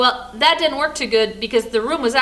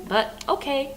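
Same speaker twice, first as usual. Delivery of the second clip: ordinary delivery, nothing marked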